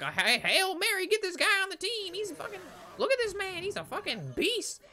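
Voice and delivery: squealing fanboy hype; hyped school recruiter. Retold or imitated. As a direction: gush over the new recruit like a fired-up coach. School hyping the big recruit.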